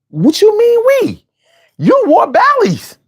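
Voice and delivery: high incredulous mock challenge; scoffing comeback, voice jumping high; mocking retort. Mocking a rapper's claim.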